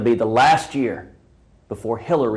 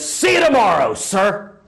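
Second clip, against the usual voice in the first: crisp saluting Marine; 10 semitones higher, 7 dB louder than usual. Retold or imitated. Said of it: "promptly salutes and says".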